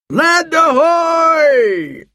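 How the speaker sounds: long high drawn-out shout; shouting from crow's nest. Acted. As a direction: call it out from the masthead. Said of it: "he shouted".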